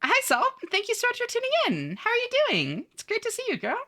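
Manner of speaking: very high put-on voice; high-pitched greeting. Streamer welcoming a viewer who tuned in, high put-on voice. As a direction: squeak out a gushing welcome in falsetto.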